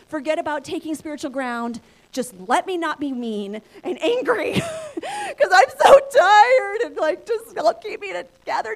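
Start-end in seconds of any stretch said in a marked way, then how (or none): 2.1-8.8 laughing plea. Retold or imitated. Exhausted mother laughing through plea.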